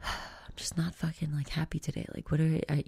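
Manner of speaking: hushed, breathless grumble to oneself; a tired whispered complaint; ego whisper. Retold or imitated. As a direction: whisper it like a sulky inner voice. Ego voiced, unvoiced whisper.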